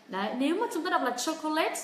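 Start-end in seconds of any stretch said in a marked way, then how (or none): 1.2-1.8 "chocolate" as "so-co-LET"; "chocolate" wrongly given three full syllables; three-syllable mispronunciation. Shown as a demonstration. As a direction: lift the voice on the final "let" of "chocolate". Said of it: "Ba âm tiết".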